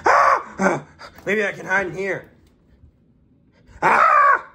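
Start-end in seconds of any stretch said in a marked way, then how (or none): 0.1-0.6 sudden very high, loud shriek; a shriek of fright; scream. Acted. 3.8-4.5 piercing high, loud shriek; a shriek of terror; high scream. Acted. Another scream.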